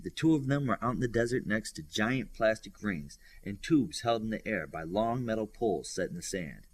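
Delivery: plain reading voice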